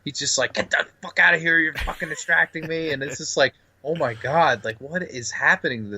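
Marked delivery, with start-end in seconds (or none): 0.5-2.9 mock snapping Jack. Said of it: "he just snaps at her".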